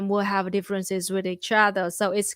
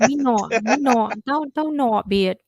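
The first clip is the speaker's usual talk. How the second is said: Vien accent. Shown as a demonstration. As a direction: keep the Vien local sound. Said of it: "Can you do a Vien impression?".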